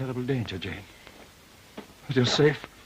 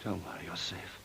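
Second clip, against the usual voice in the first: low, soft reassurance; soothing; 8 semitones lower, 17 dB quieter than usual. Acted. Rochester calms the terrified Jane, soft and low. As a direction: hush her fear gently.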